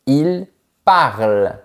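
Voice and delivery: «il parle» said slowly, final E silent; teacher's slow «il parle»; demo pronunciation. Shown as a demonstration. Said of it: "on dit".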